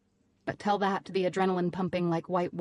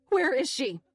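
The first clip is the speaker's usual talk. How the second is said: yelling; 7 semitones higher than usual. A narrator's reading. Narrator yelling at the Brit about Eve, yelling. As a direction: shout it at him. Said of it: "I yelled at him".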